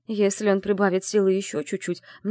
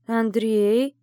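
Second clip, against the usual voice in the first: calling out; 4 semitones higher, 4 dB louder than usual. Acted. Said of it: "позвала я".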